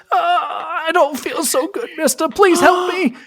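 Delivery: put-on very high, loud, slow crying child's plea; hurt child pleading. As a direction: whimper and beg like an injured kid. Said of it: "the kid says".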